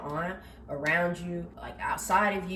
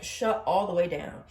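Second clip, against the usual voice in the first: ordinary talk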